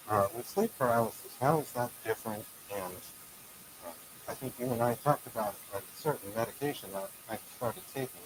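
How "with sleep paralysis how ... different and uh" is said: usual speaking voice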